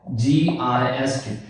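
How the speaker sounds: "जी आई एस टी" spelled slowly and softly; slow spelling. Shown as a demonstration. Teacher spelling out gist slowly. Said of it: "जैसे मैं कहता हूं कि".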